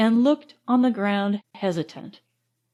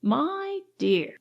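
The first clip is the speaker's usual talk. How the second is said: higher, drawn-out gentle reproof; reproving; 5 semitones higher, 4 dB quieter than usual. A narrator's reading. Mrs Bushy correcting the pleading lodger, reproving. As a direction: reprove with exaggerated patience. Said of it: "with a reproving look".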